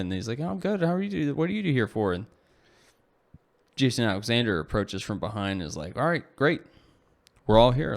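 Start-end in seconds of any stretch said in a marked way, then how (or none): 0.4-2.1 pitched way up, startled and pleased; surprised high voice. Acted. Michael answers surprised reunion. 6.0-8.0 higher and louder, a boss gathering the table; hearty announcement. Acted. Jason arrives, rallies group.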